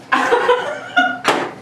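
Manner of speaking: a long, high gloating cackle; villain's gloating laugh. Acted. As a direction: laugh like a crook who got away with it. Villain gloats over trapped women.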